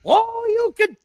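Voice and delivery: high, far-off game character call; video game voice. Retold or imitated. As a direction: shout it like a fighting game character. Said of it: "reminds me of Street Fighter Two".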